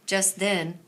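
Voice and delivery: plain reading voice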